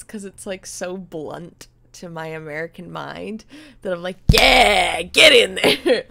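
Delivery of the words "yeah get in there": loud, drawn-out cheer; excited shout. Acted. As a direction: yell it like an eager fan. Acting out excited reaction to accents.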